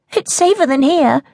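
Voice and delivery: put-on high, pushy girl's demand; insistent child. Child argues with mother.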